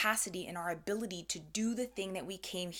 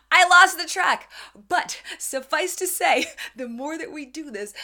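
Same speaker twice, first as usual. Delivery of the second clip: loud laughing burst, higher; 9 semitones higher, 15 dB louder than usual. Real, not acted. Laughing at herself for losing track, loud.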